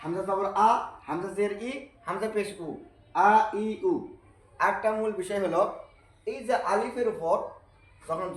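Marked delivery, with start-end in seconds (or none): 3.3-3.9 আ, ই, উ clipped short and run together; quick short vowels. Shown as a demonstration. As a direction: snap the three vowels out quickly, the correct way. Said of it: "তাড়াতাড়ি করে পড়তে হবে". (আ, ই, উ দ্রুত ছোট করে পড়া)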